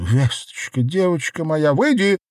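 rising high-pitched cry. A narrator's reading. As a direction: build from a call to a shout. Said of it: "закричала Веста".

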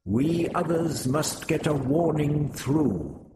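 a hint of a stern alien commander; commanding Vrestin. A narrator's reading. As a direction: order the sentry calmly. Vrestin giving orders.

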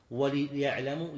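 وليعلموا slowly as "wa-li-ya'lamu"; slow وليعلموا with i after the lam; corrected kasra. Shown as a demonstration. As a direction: stretch the "wa-li" opening of وليعلموا. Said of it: "لا، هي بالكسر".

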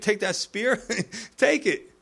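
high-pitched, chuckling advice; playful and high, with a chuckle; amused, laughing. Real, not acted. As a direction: let a laugh break into the urging. Joking advice to take spear.

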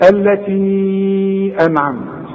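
التي أنعمت with the ي of التي held for four counts, slow; four-count madd. Shown as a demonstration. Teacher demonstrates the four-count madd. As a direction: stretch التي before أنعمت. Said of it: "علشان تمد أربع حركات".

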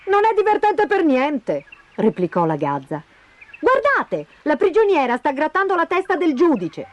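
high-pitched scolding magpie; indignant magpie. Acted. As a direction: object sharply in a high bird voice. (gazza indignata, voce acuta)

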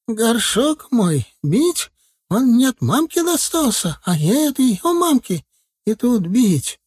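high, slow aghast granny; protesting old healer. A narrator's reading. Целительница защищает мамкин горшок, высоким протестующим голосом. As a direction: plead for the heirloom pot. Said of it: "запротестовала ещё сильнее".

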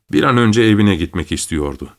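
usual speaking voice

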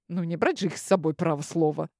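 usual speaking voice

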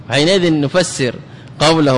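ordinary talk, nothing marked

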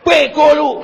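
high, loud furious yell; angry shout. Shown as a demonstration. Preacher shouting an insult to show distant hearts. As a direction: bark the insult at full volume. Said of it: "orang marah itu ... pasti teriak".

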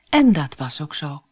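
ordinary delivery, nothing marked

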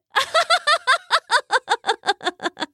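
high, giddy giggle; duck giggle. A narrator's reading. The duck giggles at her own joke, high and giddy.

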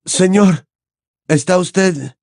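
anxious Harry. Acted. Harry asking Dumbledore, anxious hope. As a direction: ask hardly daring to hope.

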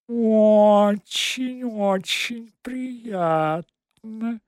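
high squeaky drawn-out cat voice. Acted. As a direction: squeak it out, very slowly. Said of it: "писклявым голосом".

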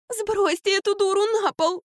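very high, slow whining demand. A narrator's reading. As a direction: demand it in a drawn-out squeal. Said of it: "потребовала Ермолова". (капризное протяжное требование)

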